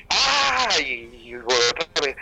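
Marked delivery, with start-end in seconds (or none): 0.1-0.7 grito agudo sostenido; un grito largo pidiendo ayuda; grito de la letra A. Retold or imitated. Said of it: "para gritar la letra A".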